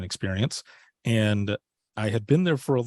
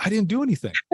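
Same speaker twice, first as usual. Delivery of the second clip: high-pitched, alarmed protest; a sudden squeak of retold panic; startled denial; 11 semitones higher, 4 dB louder, faster than usual. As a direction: blurt it out in shocked innocence. Grandma asks what he did.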